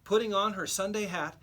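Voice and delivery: ordinary delivery, nothing marked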